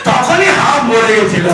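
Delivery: fast, high mock shout; top of the voice. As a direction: holler it like an oblivious deaf dad. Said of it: "at the top of the voice".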